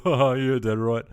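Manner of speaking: hammed-up imitation of a one-word-answer son; imitating his son. Dad mimicking his son's reply, loud mock brush-off. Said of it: "he's sort of a one-word answer".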